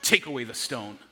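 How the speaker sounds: lightly voiced Jesus, choked with feeling; deeply moved. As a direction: say it carrying real grief, voice sunk low. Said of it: "if he's like".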